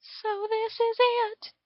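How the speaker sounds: usual speaking voice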